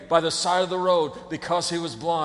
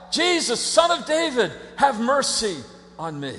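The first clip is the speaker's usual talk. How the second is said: crying out; 2 semitones higher than usual. Acted. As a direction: beg across a crowd for mercy. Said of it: "he cries out".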